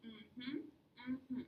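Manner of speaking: easy hummed agreement sounds; modelled mm-hmm. Shown as a demonstration. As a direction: hum mm-hmm lightly, like agreeing. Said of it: "practice our mm-hmms like this".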